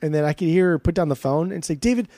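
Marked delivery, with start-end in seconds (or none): 1.8-2.1 high, rushed, irritated retelling; imitated aggressive wife. Wife complaining to David off the phone, imitated aggressive. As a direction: pitch it up in mock exasperation. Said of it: "getting aggressive with him".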